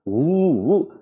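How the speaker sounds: soft eerie rumbling hum; earthquake rumble. Retold or imitated. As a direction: mimic the strange sound of the earth shaking. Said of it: "suena un sonido raro como de que".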